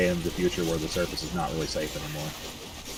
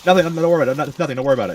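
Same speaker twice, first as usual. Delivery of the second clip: high, loud, nervous brush-off; a flustered lady hurrying to hush him; mock-panicked woman, pitched up; lady's voice; 7 semitones higher, 7 dB louder, much faster than usual. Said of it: "the lady's like".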